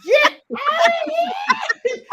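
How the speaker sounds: high, loud laughing shriek; shouted, high-pitched astonishment; amazed disbelief. Real, not acted. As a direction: squeal it in delighted disbelief. Amazed at another Bay Area guest.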